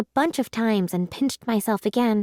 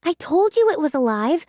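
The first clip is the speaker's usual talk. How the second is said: cried out; 6 semitones higher, 4 dB louder than usual. A narrator's reading. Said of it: "I cried".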